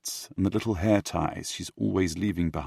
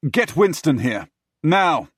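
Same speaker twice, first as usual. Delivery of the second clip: loud, sharp barked command; barking order; 9 semitones higher, 8 dB louder than usual. Acted. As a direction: bark it at the maid. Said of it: "I bark at her".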